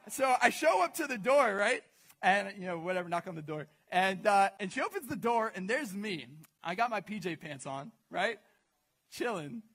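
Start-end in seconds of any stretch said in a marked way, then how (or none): none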